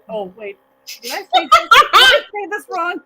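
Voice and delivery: high, loud, laughing worry; laughing self-quote. Retold or imitated. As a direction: laugh through the panicked question. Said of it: "and I went".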